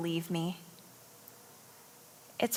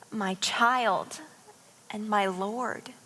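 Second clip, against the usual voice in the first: usual speaking voice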